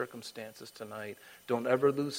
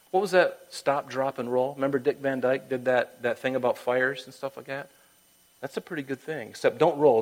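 usual speaking voice

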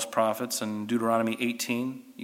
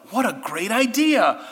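higher, dripping sarcasm; mock the foolish plan; sarcastic; 8 semitones higher than usual. Real, not acted. Teacher mocking king Ahab's disguise plan, sarcastically.

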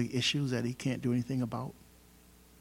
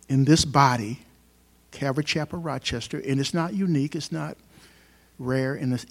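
ordinary talk

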